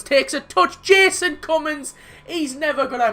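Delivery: excited goal call. Real, not acted. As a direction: build to a shout on the scorer. Commentates a goal.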